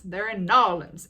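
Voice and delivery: loud, put-on New Orleans drawl; hammed-up Southern New Orleans accent. Shown as a demonstration. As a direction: punch "Narlands" as one drawled local word. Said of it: "has a drawl".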